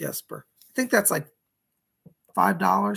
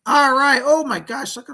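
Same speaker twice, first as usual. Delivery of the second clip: excited; 8 semitones higher, 9 dB louder than usual. Real, not acted. Seeing the donations arrive.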